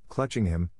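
plain reading voice

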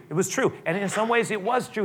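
normal talking voice

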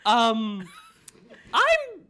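squeaky high cartoon king; King Candy. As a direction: gush like a goofy royal. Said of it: "I sound like the Mad Hatter".

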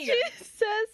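high, tearful cracking up; real laughing fit; helpless laughter. Real, not acted. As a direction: start the line, collapse laughing. Cannot get through the line.

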